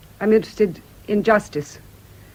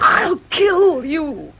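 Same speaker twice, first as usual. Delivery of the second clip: slow, high, loud cry of rage; hysterical threat; 10 semitones higher, 6 dB louder, much slower than usual. Acted. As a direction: scream it, breaking into tears. Hysterical patient threatens doctor.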